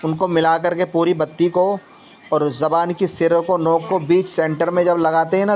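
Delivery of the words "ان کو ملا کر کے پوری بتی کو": ordinary delivery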